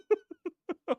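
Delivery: loud, high burst of laughter. Real, not acted. Laughs at being killed in game.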